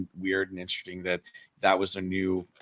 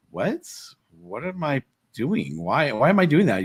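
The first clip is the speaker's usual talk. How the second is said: higher-pitched, retold disbelief; baffled retelling; 6 semitones higher than usual. Retelling his reaction to the security guard, baffled. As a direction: replay your baffled questioning, stretching the words.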